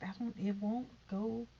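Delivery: normal talking voice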